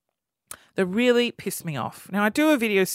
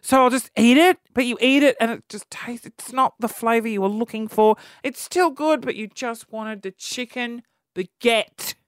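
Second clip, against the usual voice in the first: ordinary delivery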